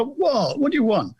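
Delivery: high, annoyed call from upstairs; young lad calling back. Retold or imitated.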